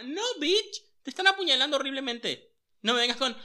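high, loud disbelieving rant; exasperated yelling at the screen. Retold or imitated. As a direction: scold the character, high and loud. Le grita a la pantalla. (gritando indignada a la pantalla, agudo y fuerte)

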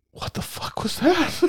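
mock whisper to the cashier; hushed, incredulous whisper; whispered aside. Aside to the cashier about the customer, hushed whisper. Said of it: "I'm like".

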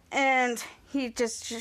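normal talking voice

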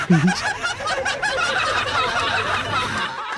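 high, breathless burst of laughter; big laugh. Real, not acted. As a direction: crack up laughing at the memory. Bursting into laughter mid-story.

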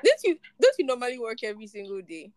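usual speaking voice

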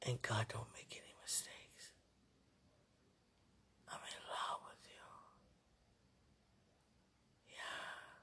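soft whisper, trailing away. Real, not acted. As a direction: whisper close and tender.

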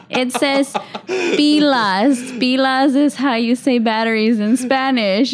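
mock exasperation, spelling it out for a caller; exasperated explaining. Agent explaining Spanish on the box to a caller, exasperated. Retold argument with confused caller.

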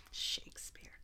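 soft, breathy whispered punchline. Real, not acted. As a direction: whisper the name as a joke. Whispered callback joke.

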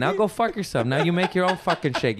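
high, worked-up pretend tirade; mock angry rant. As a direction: rant like an employee walking out. Improvising the quitting employee's rant.

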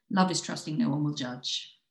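normal talking voice

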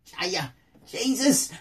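high, slow, wailing whine; high pleading voice begging Jesus for help; Jaya voice. Acted. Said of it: "I gotta do the Jaya voice".

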